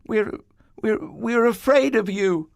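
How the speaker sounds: high, stammering scared voice; Adam confessing his fear to God; mock fearful stammer. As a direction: stutter it out like a frightened child.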